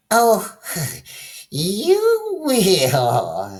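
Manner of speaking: high witch voice with a cackle; witch laughing. Acted. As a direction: laugh through it, sly witch. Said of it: "laughing up her flapping sleeve".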